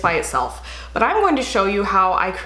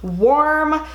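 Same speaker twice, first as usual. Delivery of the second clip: normal talking voice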